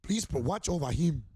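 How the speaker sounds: put-on loud, high, slow African accent. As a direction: put on a booming African accent for a scolding guest. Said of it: "I'm doing, like, an African voice".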